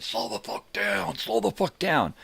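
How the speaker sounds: mock screaming woman, rising high; quoting a woman on horseback screaming; imitating a scream. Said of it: "fucking starts screaming".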